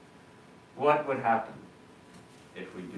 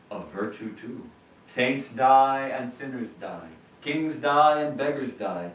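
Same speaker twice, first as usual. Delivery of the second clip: solemn quotation reading; 5 dB louder than usual. Acted. Monk reading Vivekananda to the congregation, solemn and declaiming. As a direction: proclaim it like scripture. Reads Vivekananda quote with force.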